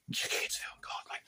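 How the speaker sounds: barely voiced muttered aside; hushed aside. Real, not acted. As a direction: drop to a near whisper. Aside about adult content.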